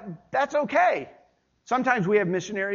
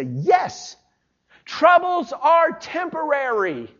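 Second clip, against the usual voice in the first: higher, slow, emphatic declaration of hope; triumphant emphasis; 4 semitones higher, slower than usual. Shown as a demonstration. Preacher voicing an encouraged reader of the verse, triumphant. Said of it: "put the emphasis on the second part".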